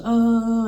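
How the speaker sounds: high, drawn-out mock scream; loud, high-pitched "ah" like a falling cry; imitated falling scream. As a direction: imitate a scream fading away as someone falls. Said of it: "you hear him screaming as the echo".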